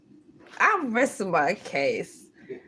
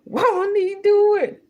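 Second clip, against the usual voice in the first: high, loud church praise; praise exclamation; 10 semitones higher, 5 dB louder than usual. Real, not acted. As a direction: belt it like a praise shout.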